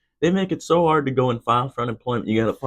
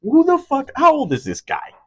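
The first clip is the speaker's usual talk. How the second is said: outraged disbelief; 12 semitones higher than usual. Real, not acted. Ranting at the camera about Gavin Newsom, outraged. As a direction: blurt it out in shocked disbelief.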